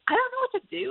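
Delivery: high, panicky inner voice. Retold or imitated. Leader panicking inside about what God wants. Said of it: "I start internally panicking".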